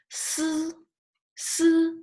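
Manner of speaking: "Si" held high and level on a hissed s; English s, then a sustained flat vowel in "Si"; si with e sound. Shown as a demonstration. Teacher introducing an easy initial to learners, si with e sound. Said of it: "have this e sound".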